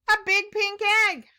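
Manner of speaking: high, loud and fast; high excited reveal. Real, not acted. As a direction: announce it with delight. Reveal of egg.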